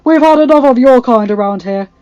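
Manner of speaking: loud, forceful warning; fierce. A narrator's reading. Giles stands between them with sword aloft, fierce. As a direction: warn her off loudly. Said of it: "Giles said fiercely".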